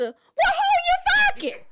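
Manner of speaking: high, loud sudden accusation; accusing yell. Pretend. Pretend wife accusing husband of cheating, sharp yell. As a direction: snap the question at him. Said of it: "Then it went from ... to".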